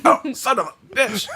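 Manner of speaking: squealing horse cry, laughing; high horse voice. Acted. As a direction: yelp it like an offended horse. Horse voice reacting to kick.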